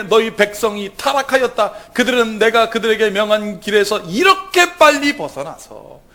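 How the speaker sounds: preacher voicing an angry God; God's voice, preached. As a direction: thunder out the Lord's anger. Reads God's words.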